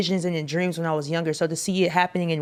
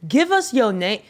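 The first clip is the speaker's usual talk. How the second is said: exasperated callout; 10 semitones higher than usual. Acted. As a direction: call the DJs out, pitched up. Calling out DJs, high and loud.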